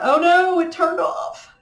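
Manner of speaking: sudden high, loud "oh no"; dismayed surprise. Real, not acted. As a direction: caught off guard as the coffee pot dies. Coffee pot suddenly switches off.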